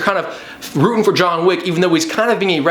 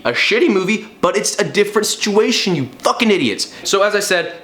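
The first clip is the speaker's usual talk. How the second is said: ordinary delivery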